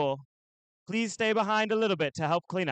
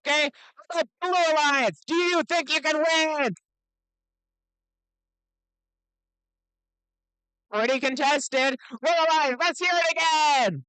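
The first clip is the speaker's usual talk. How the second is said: put-on broad singsong kids theatre. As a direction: pump up the crowd like an emcee. Event announcer hyping the crowd.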